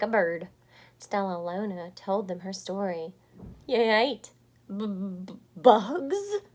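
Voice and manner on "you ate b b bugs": shocked stutter on bugs; stuttering shocked bat. Acted. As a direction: stumble over the word in disgust. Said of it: "stuttered one".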